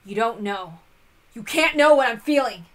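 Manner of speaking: a defensive brother finally raising his voice; angry outburst. Acted. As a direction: lash out at her for prying into his feelings. Kano snapping back at Shannon.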